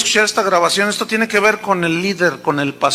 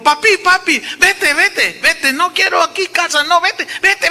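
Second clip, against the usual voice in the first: put-on much higher childlike pleading voice; imitating a small child; 8 semitones higher than usual. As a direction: push the father away like a little boy. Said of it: "Dice que mi niño le dijo".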